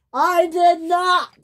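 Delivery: very high, loud, slow shout; angry denial. Acted. Thomas shouting back at James, denying blame. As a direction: yell the denial.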